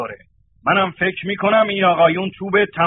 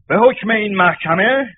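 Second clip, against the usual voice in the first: normal talking voice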